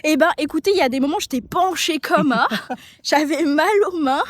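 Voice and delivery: loud and thrilled; gushing about the ride; excited. Real, not acted.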